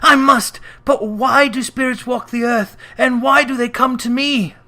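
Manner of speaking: high, loud and desperate; frightened cry. Acted. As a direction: cry it out in fear. Scrooge pleading with ghost.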